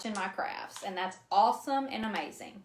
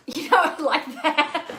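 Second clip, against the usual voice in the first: laughing; 6 semitones higher, 8 dB louder, slower than usual. Real, not acted. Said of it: "Thank you, thank you very much".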